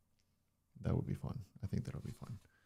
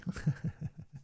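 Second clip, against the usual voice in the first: silly laugh; 9 semitones higher than usual. Real, not acted. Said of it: "letting the silly me".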